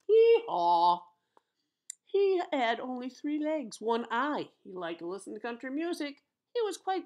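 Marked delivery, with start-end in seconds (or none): 0.1-1.0 loud imitated hee-haw; donkey bray. Donkey's bray in read-aloud story.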